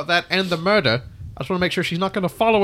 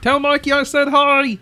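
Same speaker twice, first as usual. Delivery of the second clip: raised, high-pitched call; 8 semitones higher, 4 dB louder than usual. Acted. Character calls after the departing child, voice raised high. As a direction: call after someone running out the door.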